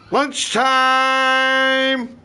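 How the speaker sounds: loud, high call of lunchtime. Acted. Calling everyone in for lunch, loud and high. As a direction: bellow it across the house.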